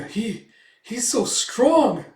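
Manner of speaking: slow, high, loud stammer; acted wide-open amazement; awestruck. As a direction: marvel at sheer strength. Elf awed by the strength.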